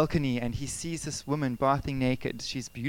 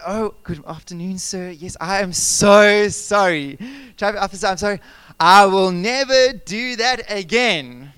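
mock-polite apology, slow and much louder on the sorry; overly polite; 2 semitones higher, 10 dB louder, slower than usual. Pastor pleading with the traffic officer, hammed-up polite apology. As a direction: lay the politeness on thick to get off the hook. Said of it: "you're always so polite".

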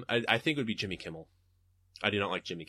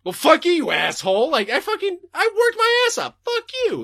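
much higher, louder hammed-up outrage; mock furious rant; 8 dB louder than usual. Worker raging at the prank boss, hammed-up fury. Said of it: "And, like".

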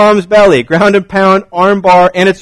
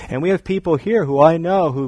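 usual speaking voice

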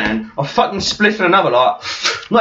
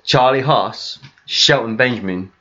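full title; 9 semitones lower, slower than usual. Retold or imitated. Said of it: "the full title".

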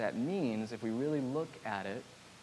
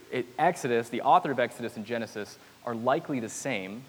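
ordinary talk

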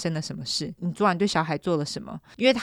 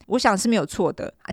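ordinary delivery, nothing marked

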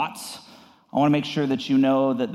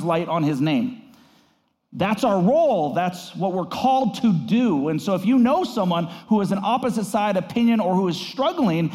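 higher, rousing sermon delivery; building preaching; 6 semitones higher than usual. Real, not acted. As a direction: preach it with rising fervor. Pastor preaching on peacemakers.

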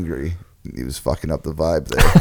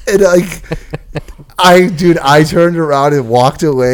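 normal talking voice

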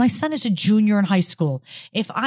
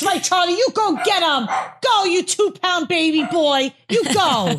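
cheering a pet; 7 semitones higher, slower than usual. Real, not acted. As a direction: hype up the little dog. Cheering on a tiny dog.

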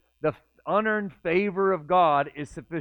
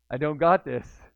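louder second take of the doubt; repeated with louder alarm; louder despair; 6 dB louder than usual. Retold or imitated. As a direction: push it out as panic sets in.

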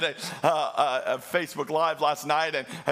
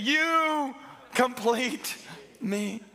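"You complete... me" drawn out and held; slow romantic line; 5 semitones higher, much slower than usual. Retold or imitated. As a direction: stretch "complete" and drop on "me". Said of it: "he says this statement".

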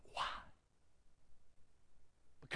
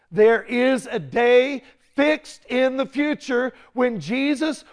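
loud, driving sermon voice; full preaching; 6 semitones higher, 12 dB louder than usual. Acted. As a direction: press the warning home.

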